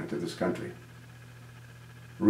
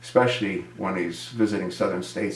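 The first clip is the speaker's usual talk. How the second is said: ordinary talk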